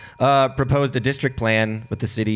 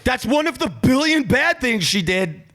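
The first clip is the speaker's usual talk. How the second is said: exasperated outburst; 12 semitones higher than usual. Real, not acted. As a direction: burst out in exasperation. Indignant interjection about the doxing.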